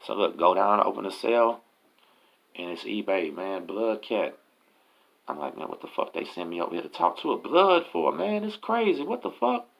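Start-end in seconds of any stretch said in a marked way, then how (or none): 5.6-9.7 high-pitched rising disbelief; pitched-up alarmed inner rant; retold incredulous protest. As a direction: act out thinking you've been set up. Alarmed thoughts at being sent there.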